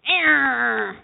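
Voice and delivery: crazy growl. Pretend. Preacher acting out the crazed man, a hammed-up growl. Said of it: "That's my rendition of crazy".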